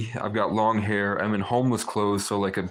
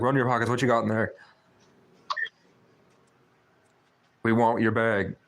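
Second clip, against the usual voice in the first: put-on quick, lightly done Irish teen accent. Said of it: "But in, in Irish accent".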